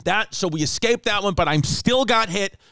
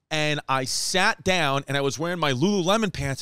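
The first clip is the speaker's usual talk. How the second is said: ordinary talk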